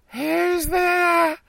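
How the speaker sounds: put-on very high, shouted old woman voice; high old granny voice. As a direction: call out like a frail grandmother. Grandmother answers the knock.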